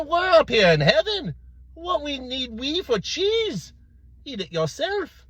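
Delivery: very high, called-out reply; high-pitched comic punchline; priest answering from above. Acted. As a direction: call down from on high, easing off in volume.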